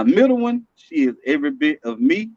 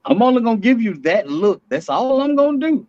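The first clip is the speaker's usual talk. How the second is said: warning dad; 8 semitones higher than usual. Acted. Acting out warning his daughters.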